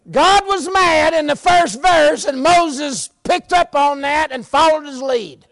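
high, loud preacher's shout, building; full-force preaching. Real, not acted. Preacher to congregation on God being mad, full-force shout. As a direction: drive it home hard, rising to a drawn-out holler.

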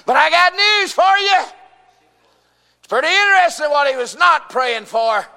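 high, loud pulpit shout; full-force preaching. Real, not acted. Preacher announcing news to his congregation, full-force preaching.

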